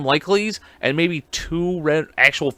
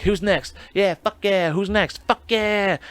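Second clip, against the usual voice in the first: imitating the woman; 6 semitones higher, slower than usual.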